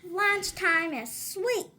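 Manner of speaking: loud, slow yell of delight; excited yell. Acted. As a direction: yell it out happily. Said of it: "yelled Clark the shark".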